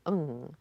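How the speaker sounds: ung said softly with a long ng; exaggerated word endings. Shown as a demonstration. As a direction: hold the ng at the end of ung. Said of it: "pronounced as N G".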